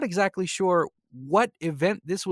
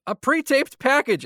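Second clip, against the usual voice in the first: higher, louder and slow, with heavy irony on "pre-taped"; sarcastic emphasis; 9 semitones higher, 5 dB louder than usual. Real, not acted. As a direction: drag out "a pre-taped package" as if it were a letdown. Sarcastic repeat of the phrase.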